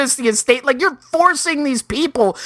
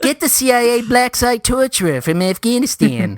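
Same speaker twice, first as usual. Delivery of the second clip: lightly put-on South African accent, a touch Australian. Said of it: "the accent is a little bit more Australian".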